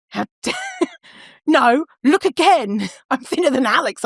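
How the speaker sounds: hammed-up insisting through giggles; indignant disbelief. Former self disputing friend's verdict, mock indignant disbelief.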